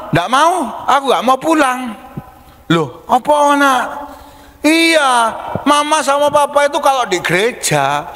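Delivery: put-on high, whiny child's refusal; a kid digging in his heels; refusing child. Anak menolak pulang. (anak menolak pulang, suara tinggi)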